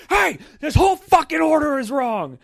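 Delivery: high, loud confrontational yell; imagined furious shout. Retold or imitated. Customer slamming the wrong order on the table, acting out a furious shout. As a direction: blast the restaurant staff in a fantasy. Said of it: "just fantasize going in there".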